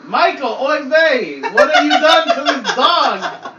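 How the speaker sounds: put-on high, loud Yiddish-accented mother. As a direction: scold the dog like a Yiddish mama.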